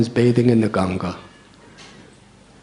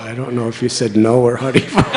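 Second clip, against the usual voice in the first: laughing; 4 dB louder, faster than usual. Real, not acted. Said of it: "because you're laughing".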